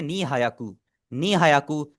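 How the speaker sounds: नी हायाकू with a full "haa" in hayaku; common mispronunciation. Retold or imitated. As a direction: imitate learners saying नी हायाकू as ni ha-ya-ku. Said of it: "वो बोल रहे होते हैं". (नी हायाकू, लोगों वाला गलत उच्चारण)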